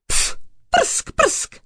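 explosive, voiceless cat spits; spitting and hissing. A narrator's reading. Cat attacking the thief, spitting. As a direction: spit the sounds out like an angry cat. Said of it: "prskala přitom a syčela".

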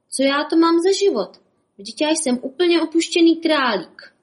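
high, loud mock-tragic wail; self-pitying wail. Bob lamenting his lonely life, mock-tragic wail. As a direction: ham up the abandoned rabbit. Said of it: "litoval se Bob".

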